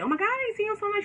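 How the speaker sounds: high, fast gushing hello; excited greeting. Retold or imitated. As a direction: gush like a happy reunion. Greeting old acquaintance in shop.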